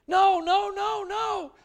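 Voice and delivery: high, loud frantic no's; panicked child. Retold or imitated. Boy about to be launched into the water, high loud panicked pleas. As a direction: shriek in panic like a scared boy.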